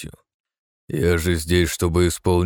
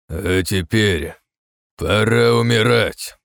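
slow, building to a loud shout; rising threat; 4 semitones higher, 5 dB louder, much slower than usual. A narrator's reading. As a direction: draw it out then roar. Archmage's death threat.

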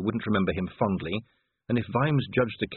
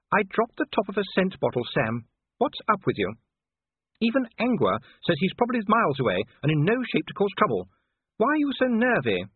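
high-pitched, questioning wifely voice; puzzled wife; 10 semitones higher than usual. A narrator's reading. Sybil asks Vimes why he is nervy, puzzled high voice. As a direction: question a jumpy husband, pitched up.

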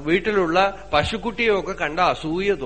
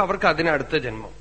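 ordinary delivery

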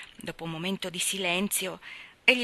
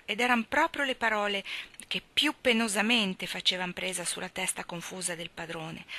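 ordinary delivery

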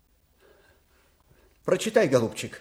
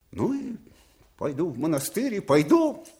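stubborn outburst; 8 semitones higher, 6 dB louder than usual. Acted. Old man flares at servant.

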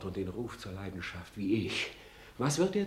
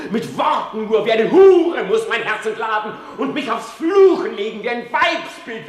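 high, loud raging outburst; furious shout; 11 semitones higher, 8 dB louder than usual. Acted. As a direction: rail at himself at full voice. Hamlet's monologue in bitter rage. (zornig geschrien)

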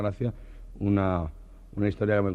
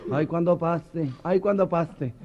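higher giddy repetition; giddy waiting; 9 semitones higher, slower than usual. Retold or imitated. The friends awaiting the procession, giddy and high. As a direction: bounce with anticipation. Repeated excited anticipation.

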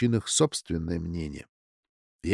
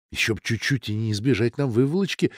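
ordinary delivery, nothing marked

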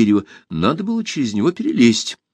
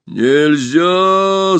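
loud growling refusal; monster growl; 6 semitones higher, 5 dB louder than usual. A narrator's reading. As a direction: snarl it like a beast. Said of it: "зарычало чудовище".

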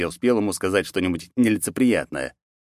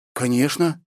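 high, rising puzzled answer; uncertain, questioning lift; still bewildered; 8 semitones higher, slower than usual. A narrator's reading. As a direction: answer while still baffled. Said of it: "всё ещё недоумевала Андросова".

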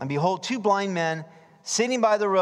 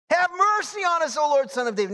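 crying out; 10 semitones higher, 3 dB louder than usual. Acted. Crying out all the more.

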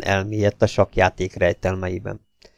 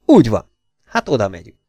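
plain reading voice

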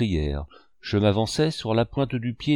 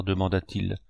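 ordinary delivery

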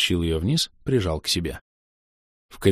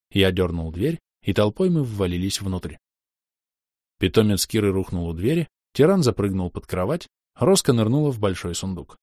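ordinary delivery, nothing marked

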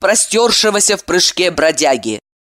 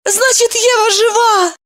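joyful exclaim. A narrator's reading. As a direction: burst out with joy. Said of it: "Евдокия обрадовалась".

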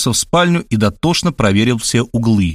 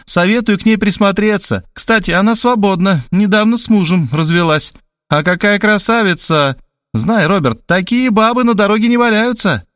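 higher, pushy matchmaker; Tamara's voice; 9 semitones higher than usual. A narrator's reading. Tamara on the phone pushing the match, insistently. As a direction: sell the woman to him.

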